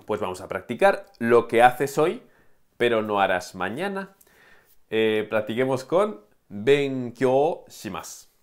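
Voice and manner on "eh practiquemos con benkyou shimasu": slow, careful model sentence for learners; slow teaching read. Shown as a demonstration. Teacher to students, slow careful model. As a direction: spell it out slowly so they can follow. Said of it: "Prefiero que escuches bien".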